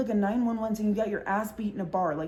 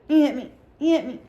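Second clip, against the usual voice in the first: much higher, louder mock drunk whine; drunk insisting; 5 semitones higher, 6 dB louder than usual.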